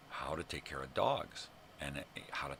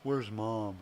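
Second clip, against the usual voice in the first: a hint of a forlorn dog, higher and louder; lightly voiced dog; 7 semitones higher, 6 dB louder than usual. Dog on the porch looking for Mom, lightly voiced. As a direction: lightly put on a waiting pet, voice lifted. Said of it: "looking into the window like".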